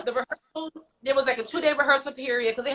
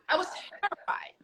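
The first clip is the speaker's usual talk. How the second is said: softer, whispered echo of the line; whispered repeat; 8 semitones higher, 8 dB quieter than usual. Shown as a demonstration. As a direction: drop to a whisper on it.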